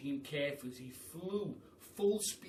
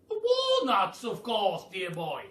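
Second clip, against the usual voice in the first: loud, grand aristocratic Duke, higher; booming Duke; 6 semitones higher, 11 dB louder, slower than usual. Acted. The Duke welcomes the hungry giraffe, booming and grand. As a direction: boom it out like a jolly grand duke.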